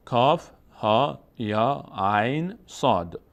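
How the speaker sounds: each name said slowly, one by one; slow letter reading. Shown as a demonstration. Teacher reading Maryam's opening letters slowly. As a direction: read کاف ها یا عین صاد slowly.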